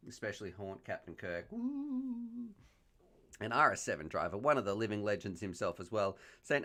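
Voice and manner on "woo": high, long ghostly "woo"; playful haunting howl, softer and high; mock ghost wail. Host threatening to haunt viewers, pretend ghost wail. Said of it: "I'll come back and haunt you".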